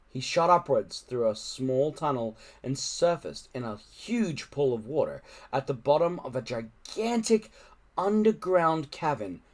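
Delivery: plain reading voice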